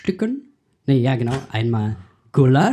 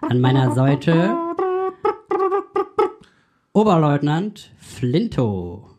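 mock radio host intro; cheesy show opener; podcast host; 7 semitones higher than usual.

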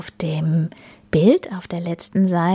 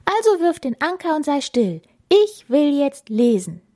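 put-on high-pitched, firm girl's voice; insistent Bella; 9 semitones higher than usual. Bella telling the captain to be still, insistent.

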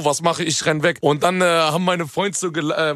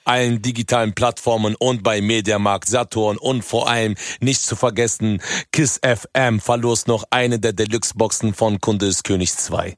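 wie ein eingeübter Radiospot, langsam vorgetragen; Werbeansage; 4 semitones lower than usual. Acted. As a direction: preist sein Album an wie ein Werbesprecher. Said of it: "das hast du jetzt aber geübt". (Rapper liest Albumwerbung im Radio, Werbestimme)

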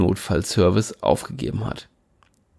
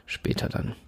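whisper; 11 dB quieter than usual. A narrator's reading. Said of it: "resigned aside".

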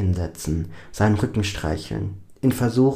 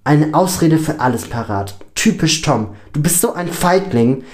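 higher, louder and scornful; shouted accusation; 6 semitones higher, 5 dB louder than usual. A narrator's reading. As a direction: build up to calling out the insult. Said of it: "ruft Lini". (wütend gerufener Vorwurf)

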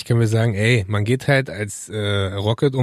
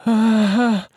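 man flu; 6 semitones higher, much slower than usual. Retold or imitated. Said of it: "bei uns ist so".

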